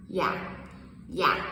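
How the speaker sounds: "yeah" low and stopped short; cut-off yeah. Shown as a demonstration. Teacher showing tone mark two: low, stopped "yeah". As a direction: clip "yeah" low like a period. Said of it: "That's the sound".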